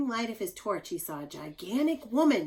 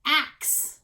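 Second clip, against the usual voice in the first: axe punched high and loud; stress on axe; 7 semitones higher, 5 dB louder than usual. Shown as a demonstration. Teacher highlighting the X word for the class, punched. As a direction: land hard on axe. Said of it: "the axe has an X at the end".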